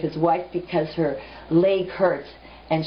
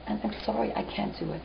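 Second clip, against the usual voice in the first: tearful whisper; 4 semitones higher, 9 dB quieter, faster than usual. Acted. Daughter breaks down before crying.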